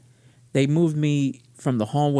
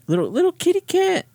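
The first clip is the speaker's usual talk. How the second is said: put-on much higher, cooing baby talk; cutesy kitty voice. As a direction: coo it like talking to a kitten. Repeats in cutesy high voice.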